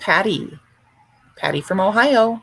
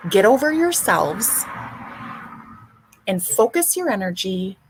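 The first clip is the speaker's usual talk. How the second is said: normal talking voice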